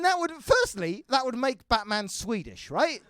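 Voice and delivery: ordinary talk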